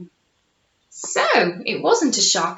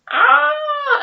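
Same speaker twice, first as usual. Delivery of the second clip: high startled ah; startled gasp; 5 dB louder than usual. A narrator's reading. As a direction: gasp as the monster appears. Monster appears.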